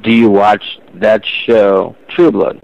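slow, word by word; slow repeat. Real, not acted. Caller repeats question after "Do what?", slowly and deliberately. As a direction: spell it out slowly for a listener who missed it.

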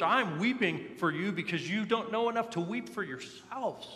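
high, urgent emotional appeal; impassioned preacher's plea. Acted. Famed preacher pleading with a crowd, impassioned imitation. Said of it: "he would speak with such emotion".

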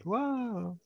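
high, soft amazed whoa; be dazzled by the rich man; awed whoa. Retold or imitated. Seminarians listening to the benefactor, a high soft whoa. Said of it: "we'd be like".

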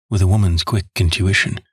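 usual speaking voice

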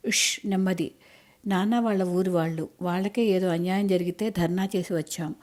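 ordinary delivery, nothing marked

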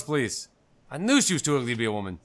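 ordinary talk, nothing marked

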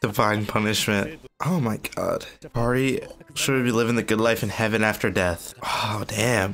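normal talking voice

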